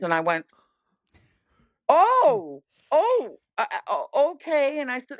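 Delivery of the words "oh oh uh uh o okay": starstruck. Retold or imitated. Starstruck at meeting famous director.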